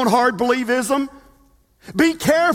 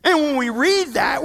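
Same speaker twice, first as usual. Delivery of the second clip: ordinary delivery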